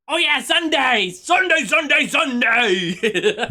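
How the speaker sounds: high, loud, repeated hype shout; hype announcer. Pretend. Streamer suddenly remembering Sunday's plans, loud mock-hype shout. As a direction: belt it out like an event ad, stretching the last one.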